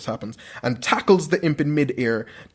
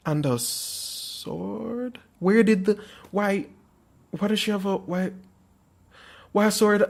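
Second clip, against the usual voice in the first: baffled; 5 semitones higher than usual. Real, not acted. Narrator stumped by his dream's sword, baffled. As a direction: sputter in confusion, pitched up.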